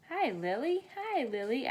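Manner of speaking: put-on high, sing-song greeting to a baby; baby talk. A narrator's reading. Olivia cooing at baby Lily, sing-song. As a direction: coo at the baby. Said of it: "I say".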